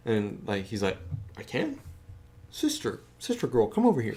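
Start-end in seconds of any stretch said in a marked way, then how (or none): 1.4-4.2 very high singsong summons; high teasing guy; mock high calling out. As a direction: call her over in a silly squeal.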